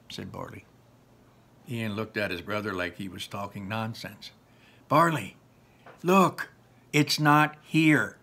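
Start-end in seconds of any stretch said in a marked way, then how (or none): 4.9-8.2 slow, insistent and louder; exasperated. Acted. Ian insisting to Barley, slow and exasperated. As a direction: drag it out in exasperation.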